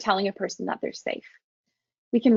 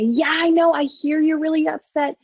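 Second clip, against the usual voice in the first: higher and louder, urgent and engaged; matching intensity; 6 semitones higher, 3 dB louder than usual. Shown as a demonstration. Counsellor matching a distressed person's intensity. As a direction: meet their upset energy head on. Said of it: "I was matching some intensity at the beginning".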